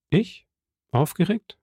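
high, rising disbelief; incredulous questioning. Acted. Count answers young man's claim he is agitated, incredulous questioning. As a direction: toss back two high, astonished questions.

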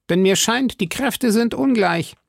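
high, blustering musketeer voice; exclaiming. A narrator's reading. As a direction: call out the suggestion, pitched well up. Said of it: "rief Porthos". (hoch ausgerufen, mit Figurenstimme)

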